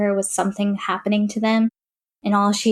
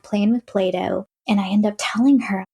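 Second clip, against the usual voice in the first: ordinary delivery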